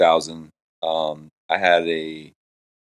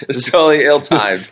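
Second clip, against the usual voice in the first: laughing; 7 semitones higher, 8 dB louder than usual. Real, not acted. Laughing at bad sound effect.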